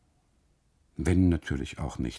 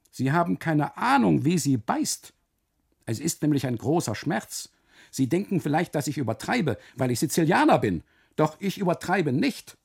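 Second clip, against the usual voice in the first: pained high complaint; 8 semitones higher than usual. Acted. Custodian complaining of pain.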